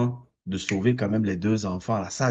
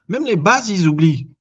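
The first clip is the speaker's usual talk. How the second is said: emphatic; 6 semitones higher, 8 dB louder than usual. Real, not acted. Heated rant about superhero films.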